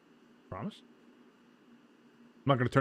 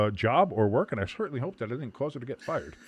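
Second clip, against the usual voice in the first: ordinary talk, nothing marked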